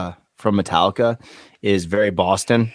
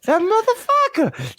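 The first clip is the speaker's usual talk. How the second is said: high, drawn-out pretend fury; mock outrage; slower than usual. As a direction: howl it in comic indignation. Imagined outraged listener.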